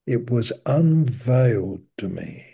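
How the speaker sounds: ordinary delivery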